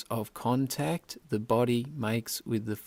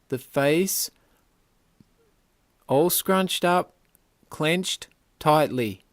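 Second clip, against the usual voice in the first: urging tension; 3 semitones higher, 4 dB louder than usual. Real, not acted. Yoga guide has listeners clench toes to face, urging tension. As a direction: drive each body part up into the squeeze.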